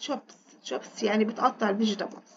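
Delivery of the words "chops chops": chops said in a whisper; whispered word. Shown as a demonstration. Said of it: "أو she chops". (همس كلمة chops)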